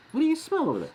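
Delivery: high, playful dog-directed voice; talking to the dog. Real, not acted.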